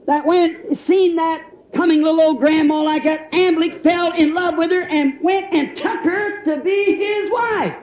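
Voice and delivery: high, building preacher's cry; fervent storytelling from the pulpit; rousing preaching. Real, not acted. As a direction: drive it up to a ringing finish. Preaches at full force.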